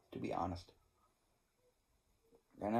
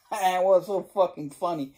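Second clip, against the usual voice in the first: amused; 8 semitones higher, 11 dB louder than usual. Real, not acted. Amused at neighbor's story.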